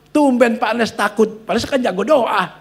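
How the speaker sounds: imitating loud, high-pitched mockery; mocking taunt. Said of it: "Saudaraku digituin".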